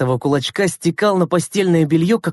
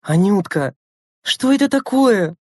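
higher, drawn-out shocked question; stunned exclamation; 6 semitones higher than usual. A narrator's reading. Stunned Lena to her messy little sister, exclaiming. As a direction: exclaim in disbelief. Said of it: "воскликнула обомлевшая Лена".

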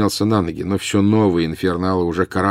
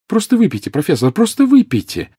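urgent pleading; 12 semitones higher, 5 dB louder than usual. Acted. Harry urging Dumbledore to drink.

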